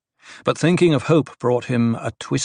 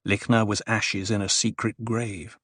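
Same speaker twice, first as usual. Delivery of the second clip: plain reading voice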